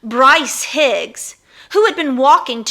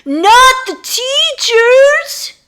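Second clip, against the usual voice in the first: high, loud, slow dismayed plea; desperate cry; 14 semitones higher, 7 dB louder, much slower than usual. Acted. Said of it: "he cried in vain".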